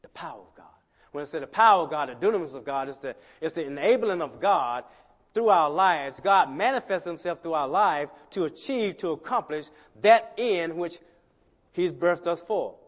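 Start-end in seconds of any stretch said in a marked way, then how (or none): 1.1-12.7 loud, high preaching climax; preaching at full force. Acted. Preacher booming the power of God to the church. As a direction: thunder it out.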